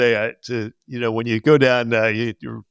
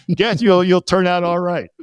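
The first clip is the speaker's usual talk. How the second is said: put-on higher, approving old teacher; fond teacher; 6 semitones higher than usual. Teacher reassuring his former student, higher. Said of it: "and he's".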